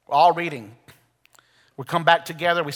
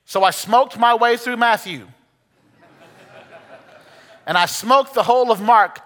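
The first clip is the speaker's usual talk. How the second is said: louder and higher, building the testimony; rising preaching climax; 6 semitones higher, 5 dB louder than usual. Acted. Pastor retelling the convert's story, preaching louder and higher.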